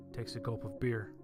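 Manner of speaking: usual speaking voice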